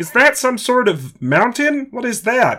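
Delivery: high, slow puppet frog voice; Kermit the Frog. Pretend. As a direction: squeak it out like a felt frog. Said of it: "Kermit, it's a chin".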